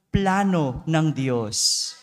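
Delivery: slow, stressed phrase for the crowd to echo; call and response. Shown as a demonstration. Preacher leading congregation to repeat, slow and stressed. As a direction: lead the congregation to repeat it slowly. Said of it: "Sabihin niyo nga po".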